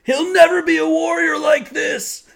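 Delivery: loud, high mock-angry yell; father's shout. Father yelling about his son, loud mock anger. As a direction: bellow it at the boy. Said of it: "And he goes".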